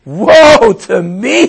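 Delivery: high, very loud drawn-out wail; anguished cry. A narrator's reading. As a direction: cry it out slowly at full voice. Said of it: "he cried".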